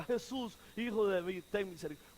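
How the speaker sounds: low, quiet imitated plea; no need to shout, he is near; murmured plea that fades away; soft close-range call. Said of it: "¿para qué gritar tanto? Y estaba cerca".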